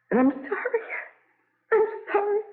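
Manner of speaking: sobbing apology, pitch cracking upward; choking on tears as she apologizes; crying. Acted. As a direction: voice breaking high on the second sorry. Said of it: "Nothing to cry about".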